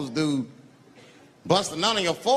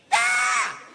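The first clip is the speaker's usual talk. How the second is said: very high battle scream; crazed scream; 3 dB louder than usual. Acted. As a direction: scream like someone who just snapped. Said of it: "He lost his mind".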